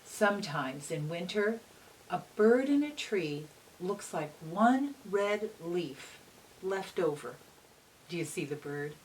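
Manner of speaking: bright, lively read-aloud voice; reading to children. A narrator's reading. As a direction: read it like storytime for little kids. Reads picture book aloud.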